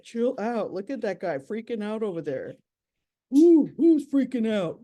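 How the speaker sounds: lightly voiced cool cat, loud teasing at the end; a hint of a chill big cat mocking the nervous one; laid-back mountain lion. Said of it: "what this mountain lion would say".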